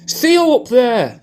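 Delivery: high, slow mock call up the stairs; calling up the stairs to ask after Theo, high and slow; shouting up. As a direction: draw it out upward in a put-on high voice. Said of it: "could be shouting up, going".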